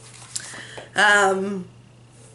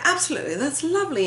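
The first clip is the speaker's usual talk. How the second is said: high, proper-sounding British lilt; British accent; 7 semitones higher, 3 dB quieter, faster than usual. Acted. Playing with her niece, British accent. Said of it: "do a British accent with".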